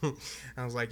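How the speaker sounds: normal talking voice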